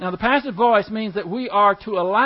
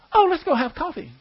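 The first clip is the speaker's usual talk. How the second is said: higher-pitched, breezy mock invitation; mock casual cheer; 8 semitones higher than usual. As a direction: toss it off as if nothing had happened. Sudden switch from rage to friendliness.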